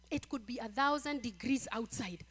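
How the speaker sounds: ordinary delivery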